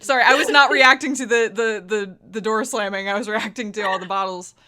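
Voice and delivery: laughing apology. Real, not acted. Laughs at clattering bottles.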